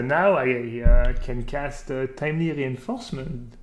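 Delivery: ordinary delivery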